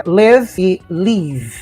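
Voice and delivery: "live" short i then "leave" long ee; teacher contrasts the "live" and "leave" vowels slowly. Shown as a demonstration. Teaching Brazilians live versus leave, slow vowel contrast. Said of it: "Por exemplo".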